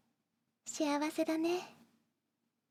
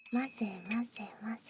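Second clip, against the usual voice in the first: whispered stirring chant; 3 semitones lower, 4 dB quieter, faster than usual. Acted. Stirring tea sound words.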